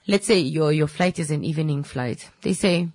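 normal talking voice

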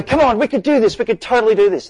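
rally reluctant colleagues, pitch way up; pleading enthusiasm. Retold or imitated. Eager innovator coaxing colleagues, excited pleading. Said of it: "You go".

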